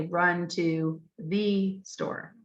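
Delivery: "Run to the store" dragged out, each word separate; very slow word by word. Shown as a demonstration. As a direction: sound out each word very slowly. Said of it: "Or they might even say".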